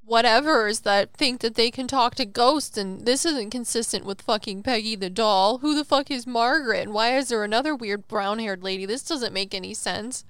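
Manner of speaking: loud, scornful mock of a doubter; mocking skeptic. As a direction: sneer like an online critic. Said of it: "They're gonna be like".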